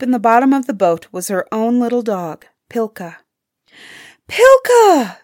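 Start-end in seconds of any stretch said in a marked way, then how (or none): 4.3-5.2 high, loud, joyful-story call to a dog; crying out. A narrator's reading. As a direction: call the dog's name loudly, pitched way up. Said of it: "Ilona cried".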